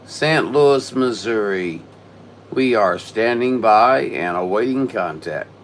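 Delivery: loud, slow deliberate callsign; radio call. Real, not acted. Ham operator calling CQ with callsign, slow loud radio call. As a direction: spell out the callsign slowly and loud over the air.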